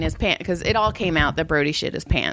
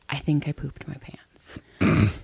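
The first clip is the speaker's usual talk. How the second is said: put-on slow, hushed child's whisper; retold kid whisper; whispered confession; 4 semitones lower, 4 dB quieter, slower than usual. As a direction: whisper it like an embarrassing secret.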